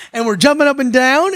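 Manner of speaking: ordinary talk, nothing marked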